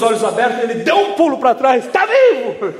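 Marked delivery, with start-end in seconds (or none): 1.9-2.7 high-pitched mock startle; startled cry. Bystander finds the supposed corpse's eyes open, startled cry. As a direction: blurt it high, as if jumping back.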